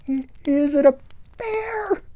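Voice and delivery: put-on high, quavering small-boy voice; a frightened kid daring to ask; scared little boy. Little Joey timidly asking the scouts about the shape.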